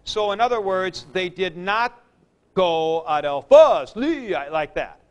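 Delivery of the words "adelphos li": "Adelphos, Li" with a loud punch on the end of Adelphos, then a drawn-out Li; two accents in a row. Shown as a demonstration. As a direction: stretch "Adelphos, Li" slowly, stressing both phos and Li as a wrong example. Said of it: "Greek never did two acute accents in a row".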